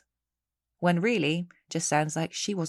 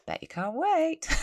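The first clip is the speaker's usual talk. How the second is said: much higher, mock-eager teasing; sarcastic tease; 11 semitones higher than usual. Real, not acted. Host teasing listeners about next episode, sarcastic and much higher. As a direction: jokingly dangle next week's horrors.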